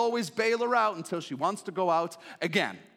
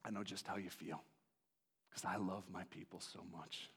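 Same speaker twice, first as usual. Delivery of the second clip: much lower, hushed and tender; God's tender consolation; 7 semitones lower, 9 dB quieter than usual. Acted. God comforts Hosea with an arm around him, hushed and tender. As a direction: comfort a grieving son. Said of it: "God's got his arm around him and says".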